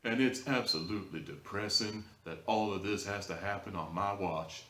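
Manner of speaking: mocking a cop's slow, soft, smooth southern drawl, a bit dramatic; hushed, buttery southern lawman impression, lightly done; Officer Warren southern drawl. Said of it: "that's basically Officer Warren in a nutshell".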